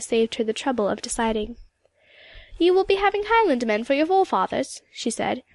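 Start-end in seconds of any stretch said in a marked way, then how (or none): 2.6-4.8 put-on powerful old Highland woman, pitched high; lilting Highland Scots question; rich Highland accent. A narrator's reading. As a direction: speak up richly in a Highland accent. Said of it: "unexpectedly rich, powerful voice, full of the delightful Highland accent".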